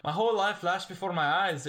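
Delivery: put-on a light Scottish accent on the quoted line; a hint of a Scottish accent, half-joking. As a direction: lilt it like a Scottish hen in the film. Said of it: "I don't know why I'm making her Scottish".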